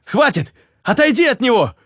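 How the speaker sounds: high, urgent cry to stop the dragon; desperate outcry. A narrator's reading. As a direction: yell at him to stop, breaking down. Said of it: "не выдержал Руслан". (отчаянный высокий крик)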